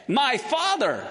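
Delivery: "father" pitched high, then falling; emphasis on father. Acted. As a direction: stress the word "father" as a son's call. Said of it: "why the emphasis on the word father".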